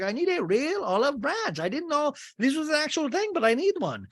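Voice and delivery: high, bright mock gushing; imitating Adriana. Adriana offering Larsa an olive branch, high and gushing.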